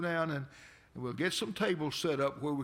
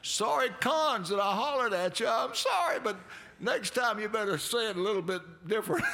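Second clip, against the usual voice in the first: excited; 7 semitones higher, 4 dB louder than usual. Retold or imitated. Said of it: "I said".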